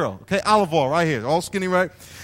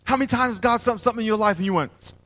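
ordinary talk, nothing marked

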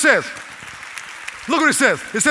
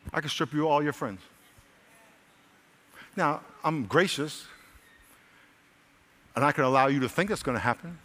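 soft, low, measured warning; hushed, restrained voice of God; very gentle; 6 semitones lower, 9 dB quieter than usual. Acted. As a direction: speak quietly with long pauses, no yelling. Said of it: "here's another way he might say it".

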